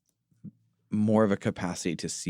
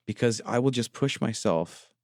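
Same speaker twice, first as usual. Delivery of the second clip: ordinary talk, nothing marked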